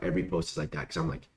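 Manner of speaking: usual speaking voice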